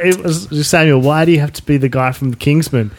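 normal talking voice

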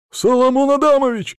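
loud, high alarmed cry; exclaiming. A narrator's reading. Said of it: "воскликнул Платон".

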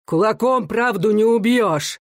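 high, slow proclaimed cry; defiant cry. A narrator's reading. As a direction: cry it out with drawn-out force. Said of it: "крикнул Рыбин".

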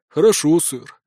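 ordinary delivery

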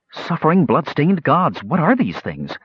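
sharp high shocked outburst; exclaiming. A narrator's reading. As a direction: blurt it out in alarm. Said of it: "Kitiara exclaimed".

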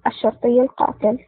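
plain reading voice

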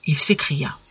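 ordinary delivery, nothing marked

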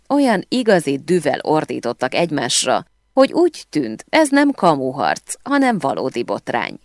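plain reading voice

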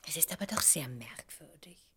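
whispered puzzlement; hushed wonder. Acted. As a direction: breathe it out in a hush. Confides the oddity in a hush.

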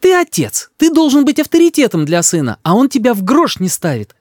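high-pitched indignant scolding; high, heated mother; accusing mother. A narrator's reading. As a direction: lecture your husband in reproach. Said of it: "говорит мама".

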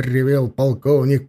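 plain reading voice

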